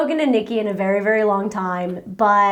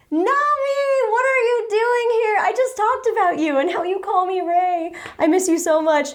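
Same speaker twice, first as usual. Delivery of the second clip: high-pitched, delighted surprise at a friend; excited greeting; 8 semitones higher than usual. Real, not acted. Spots close friend, greets her.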